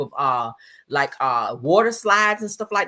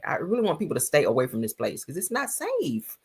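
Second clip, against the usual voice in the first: ordinary delivery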